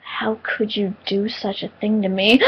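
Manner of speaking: slow, soft, gravely wounded reproach; dead serious. Retold or imitated. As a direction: retell it hushed, in solemn mock betrayal. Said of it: "I was dead serious".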